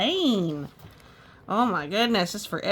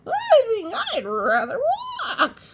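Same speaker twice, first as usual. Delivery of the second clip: high loud laughing wail; high laughing half-shout; panicked horse; 6 dB louder than usual. Acted. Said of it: "what he thought was".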